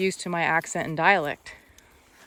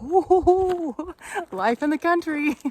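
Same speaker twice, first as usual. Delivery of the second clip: higher, laughing whoo of relief; relieved laugh; 8 semitones higher, 5 dB louder, much slower than usual. Real, not acted. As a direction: shake off the scare with a laugh. Relief after rattlesnake.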